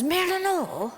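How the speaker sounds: high, light voice for the villager; villager calling out. Retold or imitated. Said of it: "one of the guys says".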